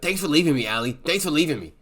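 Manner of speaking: arrested Jay blaming Allie, sarcastic; bitter reproach. Retold or imitated. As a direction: throw it back at her. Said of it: "he was like".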